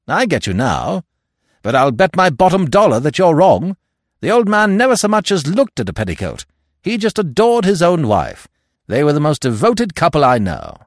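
loud, high-pitched Stoner in full protest; Stoner, insistent. Acted. Stoner defending his dead friend's marriage, loud and insistent. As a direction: argue it hard, high and loud.

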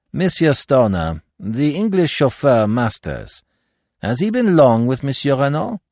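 put-on high, loud, French-accented Poirot; Poirot, inquiring. Poirot asking Stoner about the chauffeur, inquiring. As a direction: interject with a pointed question.

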